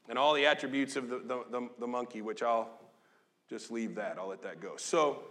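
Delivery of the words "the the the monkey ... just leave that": usual speaking voice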